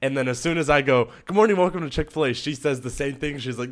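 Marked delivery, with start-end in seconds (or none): 1.2-2.3 rushed, high customer-service greeting; retold counter greeting. Trainee greeting a pretend customer, brisk retold welcome. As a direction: rattle off a higher-pitched welcome.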